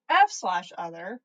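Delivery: usual speaking voice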